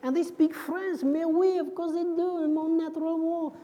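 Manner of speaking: hammed-up French accent; mock French accent, drawn out; put-on French accent. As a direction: play a stock French greeter. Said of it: "How is the accent?".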